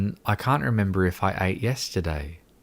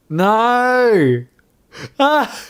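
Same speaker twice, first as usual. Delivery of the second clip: loud, high, drawn-out wail of no; high, loud outburst of frustration; exasperated cry; 13 semitones higher, 7 dB louder than usual. Real, not acted. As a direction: cry out in dismay, pitch way up. Cries out in mock despair at something.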